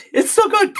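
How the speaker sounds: high squeaky mimic of her; mock her gushing in a high voice; mimicking her. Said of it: "She's like".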